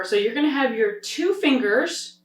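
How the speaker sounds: ordinary talk, nothing marked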